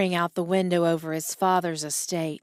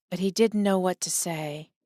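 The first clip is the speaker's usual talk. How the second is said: usual speaking voice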